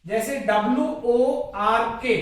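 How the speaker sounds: usual speaking voice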